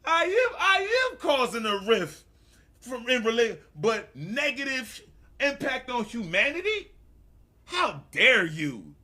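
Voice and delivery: high, loud, hammed-up indignation; mock outrage. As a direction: rant theatrically, as if deeply affronted. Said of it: "made me really turn up".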